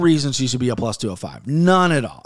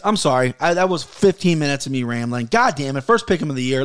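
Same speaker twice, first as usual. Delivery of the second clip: ordinary talk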